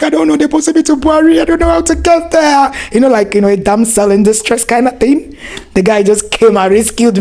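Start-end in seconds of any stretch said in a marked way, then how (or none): 0.0-2.7 put-on high, distressed young girl; retold crying. New bank girl telling Dapo her trouble, high and distressed. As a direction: plead helplessly like a lost new girl. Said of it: "I just started crying".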